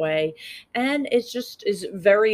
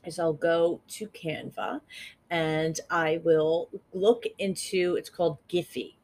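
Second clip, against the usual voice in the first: ordinary delivery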